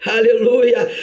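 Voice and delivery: ordinary delivery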